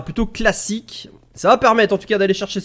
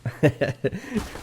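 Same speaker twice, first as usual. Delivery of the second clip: low, soft chuckle; amused chuckle; 3 semitones lower, 7 dB quieter than usual. Real, not acted. Caster reading what viewers write in the chat, amused chuckle. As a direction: chuckle quietly to yourself. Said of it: "Je rigole de ce que vous écrivez".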